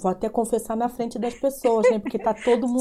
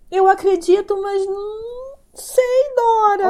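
high, drawn-out whine of uncertainty; wailing hesitantly, pitched way up; plaintive doubt; 8 semitones higher, slower than usual. Real, not acted. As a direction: confess doubt in a pleading, stretched voice. Confesses she cannot make it work.

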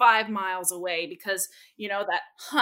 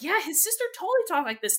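eager family agreeing; 9 semitones higher, much faster than usual. Retold or imitated. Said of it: "the family's all like".

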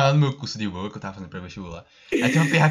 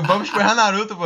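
higher, louder retold repetition; insistent repeat; 7 semitones higher, 4 dB louder than usual. Retelling a failed pickup line to a confused girl, insistent. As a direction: push the line out louder, as if unheard.

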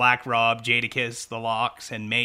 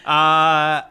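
loud laugh; 3 semitones higher, 10 dB louder than usual. Real, not acted.

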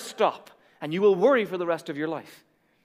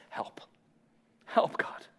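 tiny whispered cry for help; whispered plea; 10 dB quieter, slower than usual. Acted. Pastor voicing a worrier's whispered prayer to God. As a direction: barely breathe the word help.

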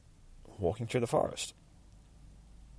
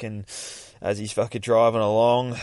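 ordinary talk

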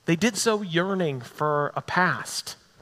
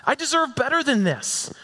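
"deserve" pitched high, an indignant complaint; 8 semitones higher than usual. Acted. A wronged believer protesting to God, "deserve" stressed high. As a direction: lift "deserve" sharply and let the line fall in resentment. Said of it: "Can you hear the anger in their voice?".

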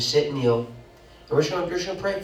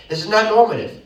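"not" higher and louder; louder repeat; 6 semitones higher, 6 dB louder than usual. Real, not acted. Preacher restating his point, higher and louder. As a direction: lift the whole line and punch "not".

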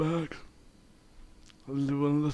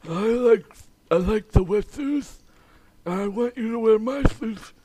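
a hint of a goofy spokesman; 4 semitones higher, 5 dB louder than usual. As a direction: play a mildly goofy spokesman. Adidas spokesman joke character.

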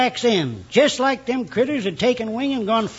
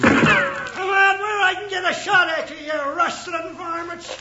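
higher, hollered threat at strangers; ornery shout; 6 semitones higher than usual. Acted. Old rancher yells at the riders by his gate. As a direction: holler at the varmints in the dark.

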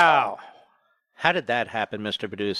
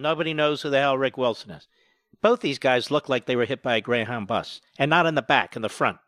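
ordinary talk, nothing marked

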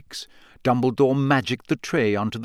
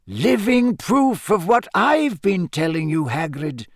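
put-on higher-pitched, measured old headmaster; genial headmaster; 7 semitones higher than usual. Headmaster asking Hagrid about the shouting, courteous. Narrator voices Dumbledore.

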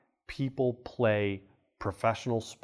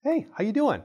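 casual friendly greeting; 9 semitones higher than usual. Retold or imitated. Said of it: "what do you say to 'em".

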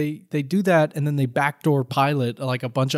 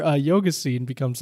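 usual speaking voice

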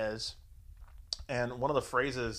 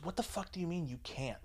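higher, softer mock outrage; retold pissed-off; 4 semitones higher, 7 dB quieter than usual. Woman hears he won't come over, mock pissed-off. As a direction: imitate someone getting pissed, held back in volume. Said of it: "she's getting pissed".

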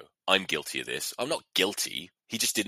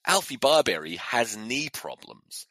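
normal talking voice

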